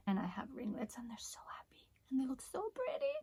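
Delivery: soft delighted whisper. Real, not acted. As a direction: whisper it, pleased and hushed. Whispered praise of curls.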